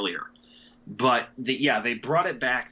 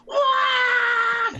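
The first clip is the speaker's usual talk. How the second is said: high loud sad-trombone wah; mock trumpet wah; 6 dB louder than usual. Mocking cheap shows' background sting, loud high wah. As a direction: blast a cartoon horn sting. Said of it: "that instrument that goes".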